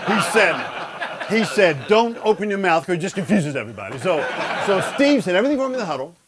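laughing while quoting the coach; amused retelling of coach's line. Real, not acted. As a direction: chuckle through the punchline. Retelling coach's funny order.

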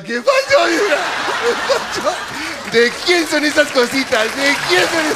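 high, drawn-out flirting call; mock flirty catcall. New father flirting with the nurse, high slow catcall. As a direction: croon it at the nurse, hammed-up.

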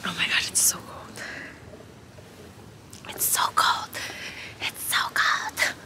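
shivering breathy whisper; gasping in icy wind. Real, not acted. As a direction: whisper through chattering cold.

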